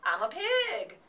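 high, loud playful cry; excited cry. A narrator's reading. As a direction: shout it out like a wild boy. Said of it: "cried Avery".

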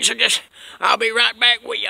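usual speaking voice

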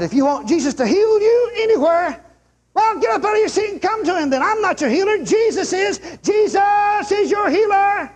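high, driving preacher's cry, stretched out at the end; rousing altar call. Retold or imitated. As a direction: call the whole room forward to be healed. Re-enacts his healing call.

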